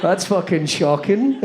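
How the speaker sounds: ordinary talk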